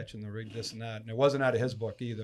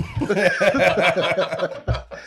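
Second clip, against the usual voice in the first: laughing; 6 semitones higher, 9 dB louder than usual. Real, not acted. Laugh after quote.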